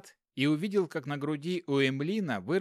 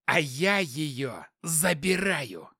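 slow menacing hiss; 2 semitones higher, much slower than usual. Acted. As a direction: hiss the threat slowly. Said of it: "прошипел". (медленно, шипя)

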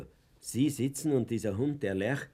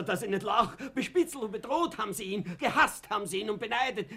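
bitter laugh; 8 semitones higher than usual. Acted.

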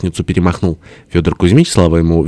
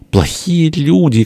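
high, drawn-out little wail; crying out; 9 semitones higher, slower than usual. A narrator's reading. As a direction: accuse them in a stretched squeal. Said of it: "крикнул из четвёртого". (тонкий протяжный обиженный крик)

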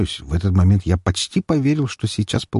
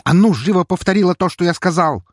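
higher, louder snapping command; barked order; 11 semitones higher, 3 dB louder than usual. A narrator's reading. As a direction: lose patience and bark. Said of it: "рявкнул я". (рявкнуть, громко)

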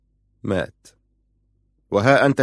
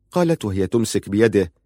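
ordinary delivery, nothing marked

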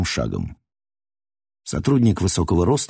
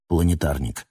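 usual speaking voice

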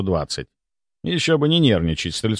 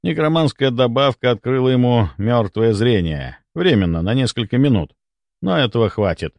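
usual speaking voice

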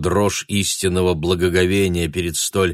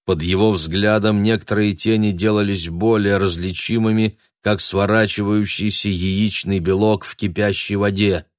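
ordinary delivery, nothing marked

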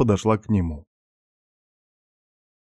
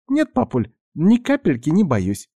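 put-on high, bright child voice; perky little girl; 9 semitones higher than usual. A narrator's reading. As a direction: play it as a cheerful little girl. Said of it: "задорно ответила девочка".

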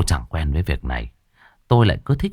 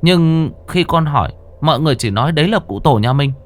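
put-on child's voice; 9 semitones higher than usual. A narrator's reading. Boy answering grandfather.

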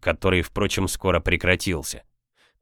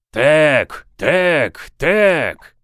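high, loud chanted cry; crowd's rallying yell; 7 semitones higher, 6 dB louder, much slower than usual. A narrator's reading. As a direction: take up the howl, one long shout at a time. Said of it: "подхватили этот вопль".

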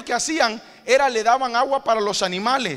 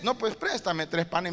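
quiet plea; 5 semitones lower, 8 dB quieter than usual. Acted. Friend quietly repeats request.

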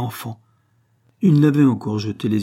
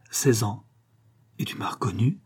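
hushed whisper; 3 dB quieter than usual. Acted. Martial whispers to the girl, amazed, in a hushed whisper. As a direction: breathe the question on an unvoiced whisper.

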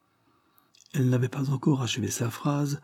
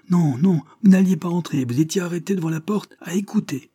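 high, loud angry denial; indignant high-pitched accusation; furious cry; 8 semitones higher, 4 dB louder than usual. A narrator's reading. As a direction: shout down the lie like an outraged child. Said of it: "cria Chad furibond".

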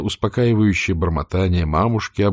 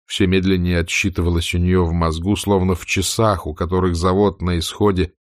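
ordinary delivery

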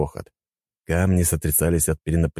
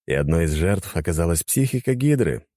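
usual speaking voice

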